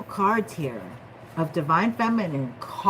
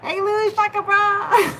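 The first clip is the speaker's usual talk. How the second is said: much higher, louder hello; high excited greeting; 13 semitones higher, 5 dB louder than usual. Real, not acted. Reader hails a viewer by name, excited. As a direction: call out a happy hello.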